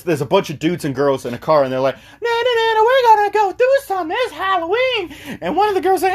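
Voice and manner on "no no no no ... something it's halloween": very high falsetto imitation; mock excited friends. Friends in a car push to go out, mock falsetto.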